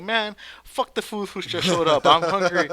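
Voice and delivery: loud, drawn-out mock complaint; exasperated, retold with comic force; annoyed retort.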